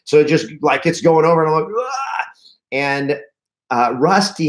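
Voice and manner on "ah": high-pitched mock scream; retold cry of alarm, shooting upward; panicked yelp. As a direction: yelp as if about to capsize. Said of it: "I'm freaking out".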